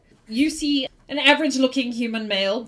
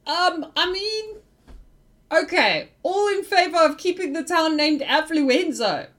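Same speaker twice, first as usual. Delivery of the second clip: reluctant leader; 5 semitones higher than usual. Acted. Joe caving to the crowd and calling a vote, higher and halting. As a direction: give in grudgingly, stalling.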